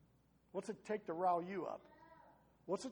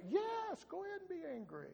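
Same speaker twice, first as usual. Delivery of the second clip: emphatic permission; 11 semitones higher than usual. Real, not acted. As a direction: push back from way up high, then come down. Emphatically overrules the quoted advice.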